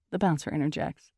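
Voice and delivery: usual speaking voice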